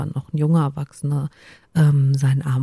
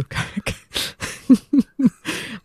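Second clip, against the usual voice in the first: stifled giggle; 8 semitones higher than usual. Real, not acted. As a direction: burst of held-back giggling. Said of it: "ich versuche nicht zu kichern".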